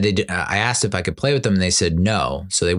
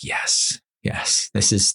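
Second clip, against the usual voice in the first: whispered; much slower than usual. Retold or imitated. Grateful for wine.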